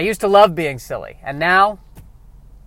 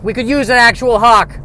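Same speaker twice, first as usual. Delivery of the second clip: mock senator; 7 semitones higher, 6 dB louder than usual. Senator hearing, mock speeches.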